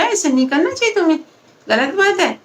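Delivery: mild scolding. Acted. Mother lightly scolds her son, quick mild scold. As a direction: chide the son gently. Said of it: "थोड़ा डांटे बेटा".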